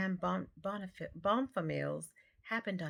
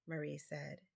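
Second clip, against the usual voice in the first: plain reading voice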